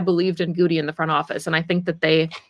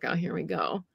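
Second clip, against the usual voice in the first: imitating a weary, resigned sigh, much softer; 9 dB quieter than usual. Fans reacting to second and twenty-seven, resigned mock sigh. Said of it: "we all sigh".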